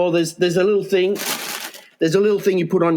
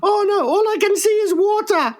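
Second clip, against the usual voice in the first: much higher falsetto voice quoting her; shrill mock bar girl. Quoting the bar girl to his co-host, high mock falsetto. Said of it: "She says".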